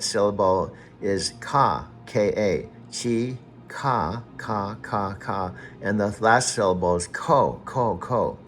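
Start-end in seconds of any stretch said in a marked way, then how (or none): none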